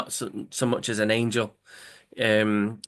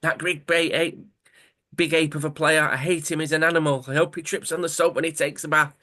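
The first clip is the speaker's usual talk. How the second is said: higher-pitched mock rant; retold angry shouting; 5 semitones higher than usual. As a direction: act out letting the anger out, without full shouting. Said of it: "and he's shouting".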